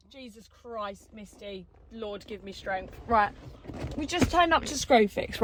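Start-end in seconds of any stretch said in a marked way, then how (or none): 0.1-3.0 praying for patience through teeth. Real, not acted. Praying for patience after rant.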